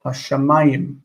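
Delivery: "hashamayim" stressed on "ma"; non-final stress. Shown as a demonstration. Teacher showing non-final word stress, "ma" leads in "hashamayim". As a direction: stress "ma" in "hashamayim", not the last syllable. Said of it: "we stress this syllable because it's not final".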